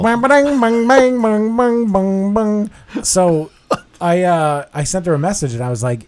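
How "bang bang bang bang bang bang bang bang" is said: high, loud mock gunshots "bang bang"; gunshot sounds. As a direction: fire off the bangs like a cartoon gun, fading away. Mimicking gunshots after name.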